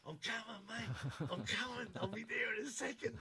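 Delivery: high-pitched mock caller yelling I'm coming; excited bloke on the phone. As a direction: shout it into the phone while running. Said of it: "He's going".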